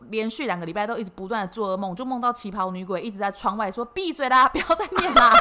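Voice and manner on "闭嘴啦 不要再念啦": loud, high, laughing mock ghost yell; a ghost telling her to shut up, half laughing. Qipao ghost scolding her chanting, loud laughing mock yell.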